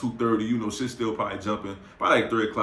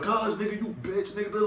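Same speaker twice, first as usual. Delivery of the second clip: high angry rant; 10 semitones higher, 3 dB quieter than usual. Retold or imitated. Going off at friends.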